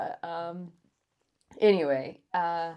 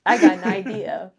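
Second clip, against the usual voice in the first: retold knowing remark; 2 semitones higher, 16 dB louder than usual. Officer retells his own thought.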